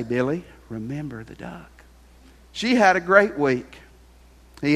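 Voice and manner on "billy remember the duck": a soft, teasing hint of blackmail; sly quiet threat. Sister quietly holding the duck over Billy, softly sly. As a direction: hush it like a secret weapon.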